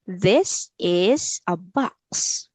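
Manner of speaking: very slow, word by word for a learner; teacher's slow repeat-after-me delivery; slow model sentence. Shown as a demonstration. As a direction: draw out each word for repeating.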